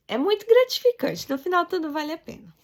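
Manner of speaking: high, loud rush of enthusiasm; excited burst. Real, not acted. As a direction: let the joy burst out. Moved, recalling the reward. (empolgação alta e forte)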